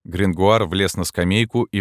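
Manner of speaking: usual speaking voice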